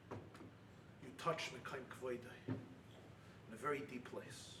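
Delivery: low, soft preaching voice; hushed reflection. Real, not acted. Rabbi to those asking why, hushed reflection. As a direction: bring it right down, quiet and grave.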